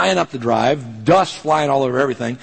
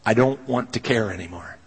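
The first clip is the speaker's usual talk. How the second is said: softer, worn-out plea to God; weary prayer; 5 semitones lower, 5 dB quieter than usual. Retold or imitated. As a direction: pray it tired and hurt. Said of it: "knowing what I'm praying".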